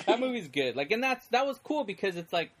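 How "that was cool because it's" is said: ordinary talk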